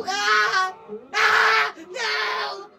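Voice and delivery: high, loud repeated screams; frightened screaming. Acted. Viewer screaming at the creepypasta, frightened. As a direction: scream in fright, holding each cry.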